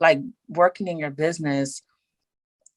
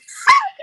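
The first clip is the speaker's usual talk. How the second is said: high burst of laughter; 5 dB louder than usual. Real, not acted. Joke about taking PTO.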